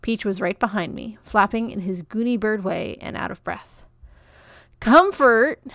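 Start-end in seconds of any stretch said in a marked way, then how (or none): 4.8-5.6 higher and louder call; calling after her. A narrator's reading. Peach calling after Comfort, a loud call. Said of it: "he called".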